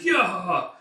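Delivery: loud, high drawn-out cry; yell. Acted. Reveller crashing about later, rowdy yell. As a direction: shout a long "yah".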